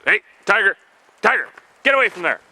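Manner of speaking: high shout to the dog; calling the dog. Real, not acted. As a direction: yell the dog's name sharply. Calling dog away from ice.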